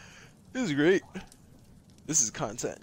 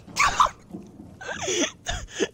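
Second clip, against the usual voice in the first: shrill squeal of laughter; squealing high laugh. Real, not acted. Squealing laugh at finding Legion.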